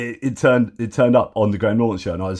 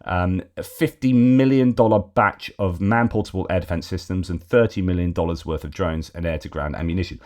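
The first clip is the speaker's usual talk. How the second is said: ordinary talk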